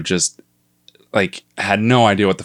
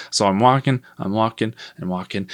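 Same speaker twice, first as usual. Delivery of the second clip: ordinary delivery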